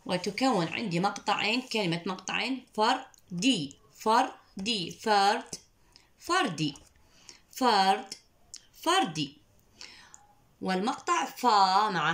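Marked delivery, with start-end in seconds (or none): 2.7-9.4 slow فردي with the final ي held; teacher modelling; phonics drill. Shown as a demonstration. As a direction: contrast فرد and فردي. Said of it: "ردد معي".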